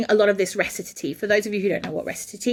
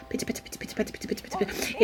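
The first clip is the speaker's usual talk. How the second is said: quick whispered pitta patter; breathless soft patter; rapid patter; 8 dB quieter, much faster than usual. Shown as a demonstration. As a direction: rattle off syllables softly and fast. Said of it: "there is so much Italian".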